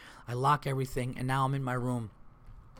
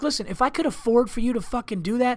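much higher, indignant snap; indignant retort; 9 semitones higher than usual. Retold or imitated. Comic answering the hotel clerk, indignant and high. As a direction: fire it back, outraged.

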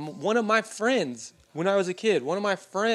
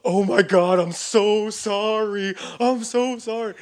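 panicked apology; 5 semitones higher, 6 dB louder, slower than usual. Retold or imitated. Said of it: "And my brother's like".

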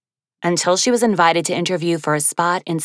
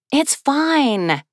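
breezy; 9 semitones higher than usual. Acted. Woman brushing it off.

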